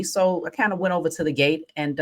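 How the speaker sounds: ordinary talk, nothing marked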